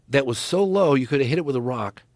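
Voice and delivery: normal talking voice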